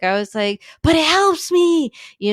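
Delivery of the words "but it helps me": high, loud sarcastic retort. Retold or imitated. Mother in labour mocking the staff, sarcastic and loud. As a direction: toss it back with heavy sarcasm. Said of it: "very sarcastic".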